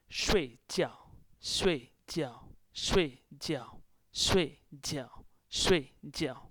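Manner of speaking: "Shui jiao" with both syllables falling sharply; "Shui jiao" repeated slowly apart, loud attack, falling tone on both; fourth-tone drill. Shown as a demonstration. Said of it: "Both are fourth tones".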